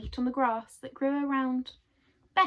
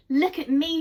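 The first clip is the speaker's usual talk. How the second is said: higher, louder joyful call; delighted cry; 6 semitones higher, 9 dB louder than usual. Acted. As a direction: cry out for everyone to look. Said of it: "she cried".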